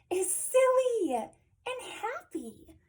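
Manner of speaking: high, slow, playful lilt; silly happy description. A narrator's reading. Reader describes a donkey's hello, high and silly. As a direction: stretch it out high and gleeful.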